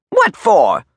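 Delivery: high, loud retort; angry demand. Acted. Major questioning the prisoner's request, high and angry. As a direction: fire the question back loudly.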